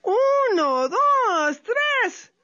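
high, loud counting out; loud high countdown. Acted. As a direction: count them off to jump. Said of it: "Tlacuache began to count".

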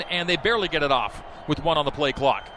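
ordinary talk, nothing marked

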